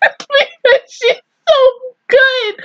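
half laughing, half sobbing; laugh-crying. Real, not acted. Laughing and crying over Chris.

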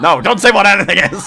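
high, loud laughing plea; laughing protest. Real, not acted. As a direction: protest while giggling. Friend teasing about mustache.

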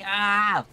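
loud, high drawn-out "ah"; startled yell. Real, not acted. Player failing a tricky move in a game, startled yell. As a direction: yell out as the move goes wrong.

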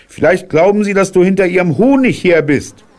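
put-on high, loud child suggesting; high boy's voice. A narrator's reading. Boy guessing what the bees think, high and loud. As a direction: say it like a bright little boy.

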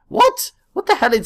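very high squeaked what; startled disbelief; shrill shocked what; surprised. Real, not acted. Weird object found in game.

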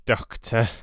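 hurry, he has minutes left; urgent dying patient. Acted. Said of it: "hurriedly and with more energy".

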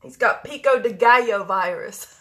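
laughing through the joke; amused chuckling delivery. Real, not acted. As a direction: giggle it out while you say it.

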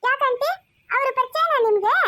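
put-on high, loud, chatty child; little girl voice. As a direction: answer brightly as a small child. Child character questioning aunt.

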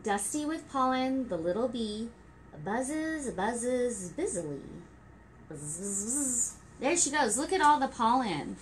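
gentle rhyming storybook read; reading to children. Acted. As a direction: read it like story time for toddlers. Reading rhyming picture book.

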